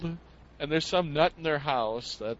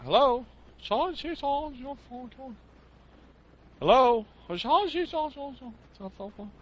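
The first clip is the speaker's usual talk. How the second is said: high squeaky gibberish with hellos; garbled phone voice; 8 semitones higher than usual. Retold or imitated. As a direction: mimic nonsense chatter down the line. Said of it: "all I can hear is like".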